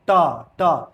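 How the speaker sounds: ट with the tongue curled back hitting the roof; a crisp curled ट, tongue tip up; retroflex t. Shown as a demonstration. Teacher demonstrating ट to students, tongue curled. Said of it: "ट बोलोगे तो देखना जीभ ऐसे मुड़ता है".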